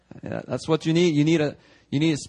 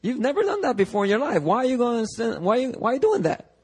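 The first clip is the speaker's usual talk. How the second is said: high, baffled exclamation at a backslider; exasperated disbelief, pitched way up; acting out a baffled pastor; incredulous mock outburst; 9 semitones higher than usual. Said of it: "And you're like".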